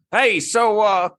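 a weak stab at a Southern drawl, pitched high; mock Southern governor, badly done; bad Southern accent. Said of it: "not a very good Southern accent".

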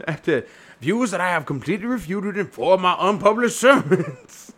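put-on comic clergyman accent; hammed-up Doctor Chasuble. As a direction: declaim it as a mock reverend.

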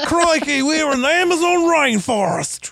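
hammed-up Australian. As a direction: ham up the Australian pilot. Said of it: "I don't know why I'm Australian when I'm doing this guy".

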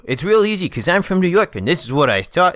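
hammed-up cartoon mobster; cartoon gangster. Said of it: "sound like cartoon gangsters".

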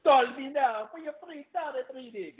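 high, put-on Jamaican accent; Jamaican psychic voice. Imitating psychic hotline ad.

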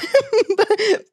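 high burst of laughter; gleeful laugh. Real, not acted. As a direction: laugh out at yourself. Laughs at own gloat.

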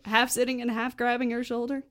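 ordinary talk, nothing marked

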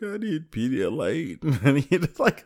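high, slow, drawn-out whine; mock pitiful crying. Telling sister what is needed, mock pitiful crying. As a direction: plead weakly in an exaggerated sob voice. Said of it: "that's how I sounded".

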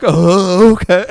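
talking on an inhale. Retold or imitated. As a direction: speak while breathing in. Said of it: "he does the inhale talk".

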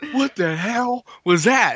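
high, slow startled question. Retold or imitated. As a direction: blurt it out, spooked.

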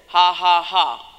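imitating a deliberate fake laugh; put-on laugh. Preacher retelling a man laughing under the covers, put-on laugh. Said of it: "I put it on".

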